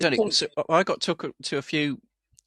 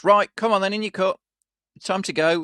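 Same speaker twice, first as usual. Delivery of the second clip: mock porter, higher and louder, rousing a patient; imitating brusque porter; 7 semitones higher, 5 dB louder than usual. Porter rousing a sleeping patient at night, brusque mock order. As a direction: play the bossy porter barking orders. Porter waking him, retold.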